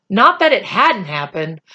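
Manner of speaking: plain reading voice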